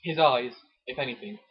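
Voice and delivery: hammed-up dramatic reading of a romance novel; romance novel narration. Reading a Mills and Boon chapter to his co-host, mock dramatic. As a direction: read it like a breathless bodice-ripper.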